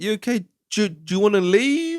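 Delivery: high-pitched tentative questioning; mock concern. Film woman asking her possessed friend to leave, high mock concern. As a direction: imitate her checking on someone in a high voice.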